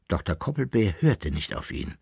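plain reading voice

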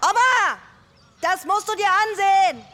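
high, loud shout across the yard; calling out. Acted. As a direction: call her down excitedly. Calling grandma from outside.

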